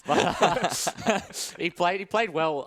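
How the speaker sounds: laughing through the words. Real, not acted. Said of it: "I can't keep a straight face".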